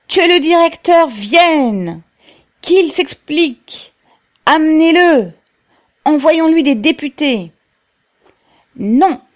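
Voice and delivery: high, loud shouted demands; crowd shouting. A narrator's reading. Dozens of workers demand the director, shouting high and loud. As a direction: yell like voices from an angry crowd. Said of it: "Quelques dizaines de voix crièrent ensemble".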